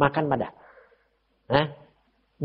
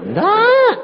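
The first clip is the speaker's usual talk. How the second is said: a much higher, louder no; sharp no; 7 dB louder than usual. Retold or imitated. Preacher retelling his blunt no, loud and high. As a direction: blurt it out.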